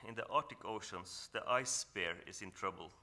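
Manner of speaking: ordinary talk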